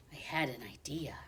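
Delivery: breathy secret whisper; whispered idea. A narrator's reading. Hamster narrator whispers his secret plan.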